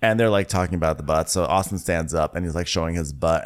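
ordinary talk, nothing marked

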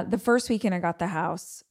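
normal talking voice

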